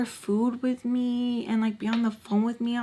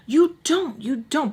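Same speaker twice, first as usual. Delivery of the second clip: high, breathy self-talk; urgent pep talk to herself; telling herself; 6 semitones higher than usual. Retold or imitated. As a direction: talk yourself out of it insistently. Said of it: "I'm, like, telling myself".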